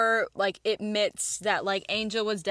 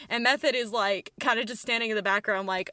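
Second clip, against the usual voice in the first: ordinary talk, nothing marked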